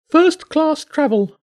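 high, loud bird voice; a pigeon relishing the ride, pitched high; high bird voice full of delight; contented pigeon. Acted.

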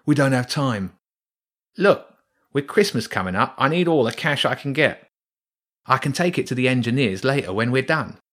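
a rat who needs the cash; rat workman. Acted. Rats argue over the cable.

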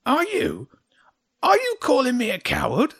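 very high, loud, outraged creature; affronted, confrontational squawk; angry hedgehog. A narrator's reading. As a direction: splutter with offence in a high voice. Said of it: "pointed the pike angrily at James".

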